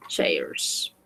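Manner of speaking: chairs whispered very softly; teacher whispering the plural chairs; whispered repeat. Shown as a demonstration. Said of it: "we say".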